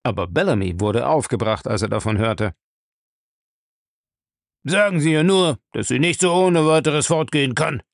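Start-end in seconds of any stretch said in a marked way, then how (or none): 4.6-7.8 put-on loud, agitated old man; excited shout. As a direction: bark out the order, worked up. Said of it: "rief er erregt".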